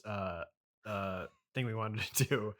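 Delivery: normal talking voice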